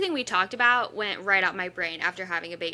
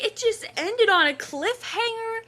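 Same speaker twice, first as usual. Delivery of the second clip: high, louder, upset cry of disbelief; distressed disbelief; 12 semitones higher, 3 dB louder than usual. Retold or imitated. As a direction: wail it like the world ended. Retelling her distress.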